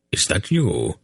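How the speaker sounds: put-on a touch of startled surprise in an old voice; lightly startled old man. A narrator's reading. Old man startled by his grandson, mildly startled. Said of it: "sounded startled".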